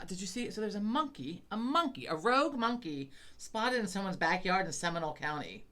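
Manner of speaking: ordinary talk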